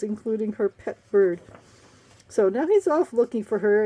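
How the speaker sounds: usual speaking voice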